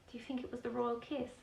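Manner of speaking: ordinary delivery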